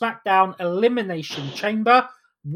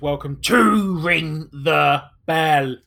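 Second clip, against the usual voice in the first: loud, drawn-out showman welcome; ringmaster; 3 dB louder than usual. Acted. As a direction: boom it out like a circus ringmaster. Opens show like circus announcer.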